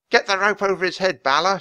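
fast, high, loud command; yelling order. A narrator's reading. Grinda yelling at Balor to rope the unicorn, fast loud. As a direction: bark it at her partner in a hurry. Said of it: "she yelled".

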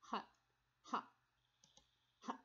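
ha with a voiceless puff of air; breathy h sound. Shown as a demonstration. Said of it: "No vibration".

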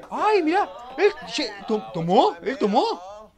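high, loud stammering surprise; shocked exclaim. Real, not acted. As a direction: gasp it out in disbelief. (sorpresa aguda y fuerte)

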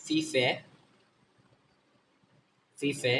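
ফিফে sounded as two syllables, fi-fe; F syllable drill. Shown as a demonstration. Teacher showing F with E, sounded out as fi-fe. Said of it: "এফ এর উচ্চারণ ফ এর মতো".

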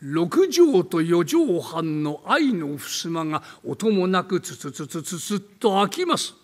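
slow, hushed eerie telling; slow creepy storyteller voice; spooky ghost story narration. Acted. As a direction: draw out the dread of a rainy night.

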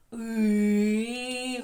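the vowel "і" held very long; drawn-out crying vowel. Shown as a demonstration. Teacher showing children a vowel sound, long sustained "і". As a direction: stretch "і" out in one continuous tone. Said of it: "який весь час плаче".

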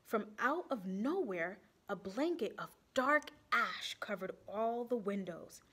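ordinary delivery, nothing marked

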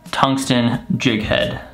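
usual speaking voice

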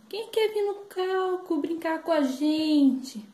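high, slow clown call; drawn-out circus clown shout. Acted. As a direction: sing out an invitation to the crowd. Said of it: "os palhaços gritavam assim".

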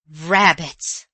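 loud, scornful exclamation. A narrator's reading. Aunt dismissing her niece's explanation, scornful. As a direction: throw the word back with scorn. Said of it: "said her aunt scornfully".

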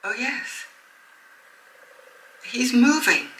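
quietly amazed, in awed disbelief; hushed amazement. Real, not acted. Sees presumed-dead chick moving in egg.

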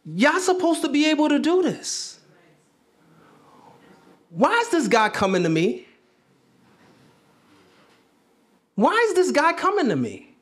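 frustrated Jesus. Acted. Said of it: "Jesus is frustrated 'cause he's like".

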